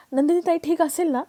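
high-pitched anxious question; a touch of fear, pitch lifted; worried. A narrator's reading. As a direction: ask it high, mildly worried. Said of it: "काव्याही घाबरलेली असते".

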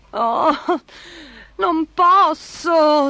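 high, very drawn-out acted sob; hammed-up tearful whimper; sobbing. A narrator's reading. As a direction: wail it slowly, as if crying. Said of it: "singhiozzò".

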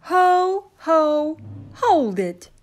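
loud, high elf shout; christmas elf cry. Acted. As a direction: stop the intruder sharply. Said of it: "cried the elf".

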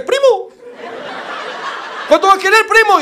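"Güero" cantado muy agudo, como taquero; llama al cliente a lo taquero; taquero llamando. Retold or imitated. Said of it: "te dice". (taquero saluda al cliente blanco, muy agudo)

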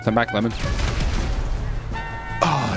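usual speaking voice

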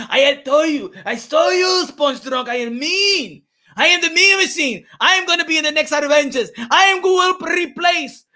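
hyped-up, soaring bragging; triumphant boast. Real, not acted. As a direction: crow it to the crowd, word by word. Triumphant boasting for the Mean Machine.

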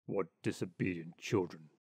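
put-on low, indignant old rat; old water rat. Old water rat scolding the ducklings, low indignant voice. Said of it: "cried the old water rat".

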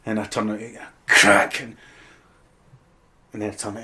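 ordinary talk

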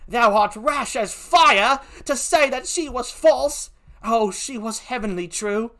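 put-on high, protesting British accent; British-accented impassioned defence; Emilia. Gentlewoman defends her mistress, high and British-accented. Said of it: "please forgive my poor British accent".

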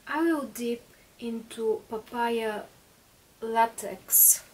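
ordinary talk, nothing marked

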